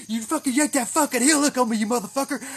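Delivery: loud, high, furious accusation; angry yelling. Retold or imitated. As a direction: re-enact chewing out an opponent. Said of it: "I fucking yelled".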